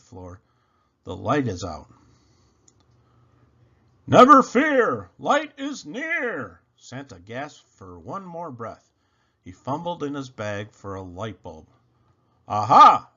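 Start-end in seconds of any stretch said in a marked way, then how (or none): 4.1-6.6 high, slow and loud hammed-up Santa; jolly proclamation. A narrator's reading. Santa's triumphant rhyme. 12.5-13.1 loud delighted aha. A narrator's reading. Finding the bulb.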